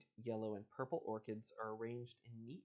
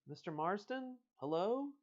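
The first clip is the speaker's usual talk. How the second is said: a raised, questioning call into the dark; 10 semitones higher, 5 dB louder than usual. Acted. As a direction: call out high into the gloom. Calling into a dark house.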